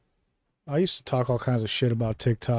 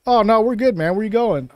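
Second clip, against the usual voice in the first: high, loud mock-casual voice of a carefree pilotless plane; plane talking; 9 semitones higher, 4 dB louder than usual. Host voicing the runaway jet carrying on alone, mock carefree. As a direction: play a breezy plane, pitched high and loud. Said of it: "the plane was just like".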